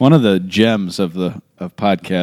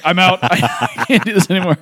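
high, laughing mock panic; panicked laughing quote; 10 semitones higher than usual. Telling a friend how he fled, laughing high. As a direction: laugh out the bailout. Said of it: "I just was like".

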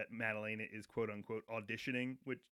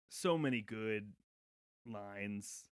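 ordinary talk

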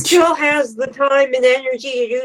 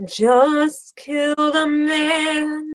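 mock dazed Suletta; 4 semitones higher, slower than usual. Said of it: "And Suletta's like".

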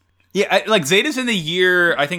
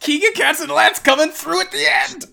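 high, loud fangirl shout; excited cheer; 14 semitones higher, 6 dB louder than usual. Real, not acted. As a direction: burst out cheering for the heroes. Cheering the kids' big moment.

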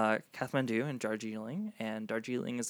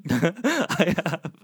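laughing mimic; 7 semitones higher, 11 dB louder than usual. Mocking Colin's reply, laughing.

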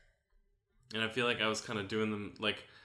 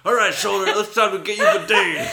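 barked drill sergeant order, much louder and higher; military drill sergeant; 12 semitones higher, 16 dB louder than usual. Pretend. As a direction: bark it like a drill sergeant, laughing. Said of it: "Military voices".

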